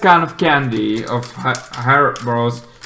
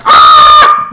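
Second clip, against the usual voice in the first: loud, high breathy mock scream; a hammed-up henchman's final scream; loud voiceless mock shriek; 9 semitones higher, 12 dB louder than usual. Henchman screams before blowing up.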